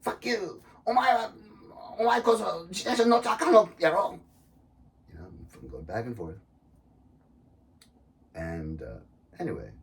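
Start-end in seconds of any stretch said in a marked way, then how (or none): 0.0-4.2 higher, louder rough Japanese comeback; heated retort. Retold or imitated. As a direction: fire it right back at him. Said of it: "I'm like".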